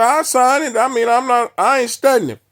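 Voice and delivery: retelling an angry outburst at the officer; heated. Retold or imitated. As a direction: snap back, offended and heated. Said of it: "I'm, I'm heated".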